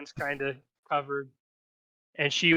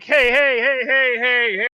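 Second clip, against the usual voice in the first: high, loud repeated heys; half-elf host's ringing call; calling for order; 8 semitones higher, 9 dB louder than usual. Acted. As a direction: shout over the crowd to settle it. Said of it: "a half elf is like".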